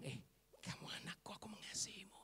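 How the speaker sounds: very soft, whispered reassurance; tender whisper. Retold or imitated. Jesus telling the rejected woman he loves her, tender whisper. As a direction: whisper it lovingly to one person.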